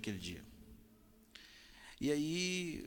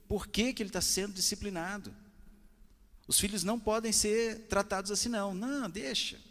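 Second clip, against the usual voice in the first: raised, emphatic pulpit exhortation; preaching; 7 semitones higher, 3 dB louder than usual. Acted. Pastor urging parents to discipline, loud preaching. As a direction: drive the point home to the congregation.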